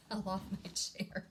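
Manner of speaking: normal talking voice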